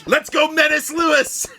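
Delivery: shouting. Real, not acted. Cheering loudly for Menace.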